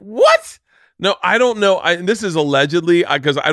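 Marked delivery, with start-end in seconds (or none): 0.0-0.6 very high, loud rising yelp; mock shocked disbelief. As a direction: react stunned, pitch leaping up. Acts shock at the prison news.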